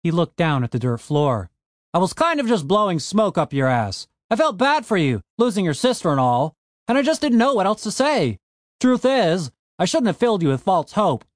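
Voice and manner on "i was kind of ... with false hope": a lightly voiced gruff dwarf, raised and fast; gruff Frank voice. A narrator's reading. Frank snapping at Tony the Elf, lightly raised gruff voice. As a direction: push back hard at the elf, brisk.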